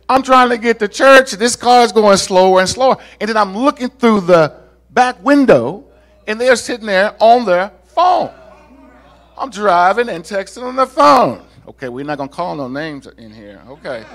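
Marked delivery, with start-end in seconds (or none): 0.1-11.4 raised, higher, indignant storytelling; exasperated retelling. Retold or imitated. Retells frustration behind slow driver.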